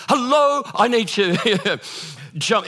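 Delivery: high, loud, hammed-up call for help; panicked shout. Trapped passenger begs to get off plane, panicked shout. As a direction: call out urgently, stretching the plea.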